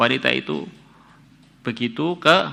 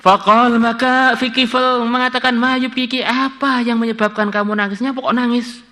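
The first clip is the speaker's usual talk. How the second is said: much higher, intoned reading of Kifl's words; raised recitation; 12 semitones higher, 3 dB louder than usual. Acted. Preacher voicing Kifl asking the woman why she weeps, high and intoned. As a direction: lift into a chanting storyteller voice.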